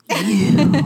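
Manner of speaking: loud hissed accusation; mock anger. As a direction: spit it at her. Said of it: "I'm like".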